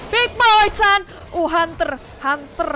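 loud high alarmed shouts; real alarm at the hunter; startled yelling. Real, not acted. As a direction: shout the warning in shock. Yelling as monsters appear.

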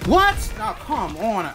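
loud high disbelieving yell; shocked outrage. Real, not acted. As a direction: shout in disbelief. Death outburst.

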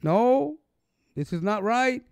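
imagined protest. Retold or imitated. Voicing the scolding the judge never gave, mildly stern. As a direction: voice the rebuke he never gave, lightly. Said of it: "He didn't say".

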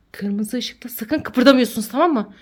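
hushed unvoiced warning; whispering while hiding. Real, not acted. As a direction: whisper so the mask won't hear.

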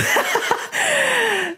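high burst of laughter; bright high laugh at herself. Real, not acted. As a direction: let a high giggle out. Laughing at own story.

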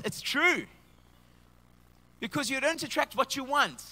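ordinary talk

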